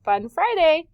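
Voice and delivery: high, loud, excited teacher call; rally the class for a treat; re-enacted classroom cheer; announcing Fun Friday. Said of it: "and I would say".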